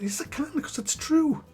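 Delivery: high, defiant comeback; defiant reply. Pretend. Talking back to his own inner voice, high and defiant. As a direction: snap back high and cheeky.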